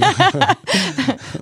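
real amused laugh; high laugh. Real, not acted. Laughs at own joke.

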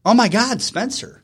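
imitating mom's high-pitched sudden recognition; surprised greeting. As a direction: act out mom suddenly spotting her son. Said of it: "it was like a light switch".

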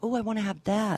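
mock covetous "oh"; imitating wowed customers. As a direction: play the customer who suddenly wants it. Imitating delighted warehouse customers.

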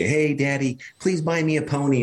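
a hint of a child wheedling Daddy; pleading child. Child asks dad to buy a pony, lightly voiced pleading kid.

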